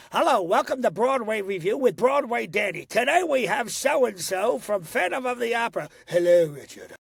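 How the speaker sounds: lilting, musical show-host welcome; warm Broadway host. Acted. Host imitating Broadway performers welcoming a radio audience, warm lilting announcer voice. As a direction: greet listeners like a trained veteran singer. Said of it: "Their voice is so warm".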